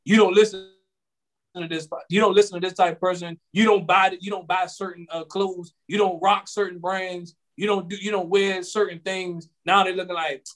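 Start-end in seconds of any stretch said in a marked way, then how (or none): none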